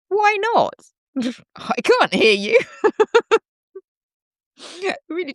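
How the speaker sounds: high squeaky giggle; giggly. Real, not acted. Laughing along with chat, squealing.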